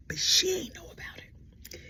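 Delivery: slow, hushed sarcasm; ironic whisper. Real, not acted. Whispered sarcastic aside about the wife. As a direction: whisper it slowly.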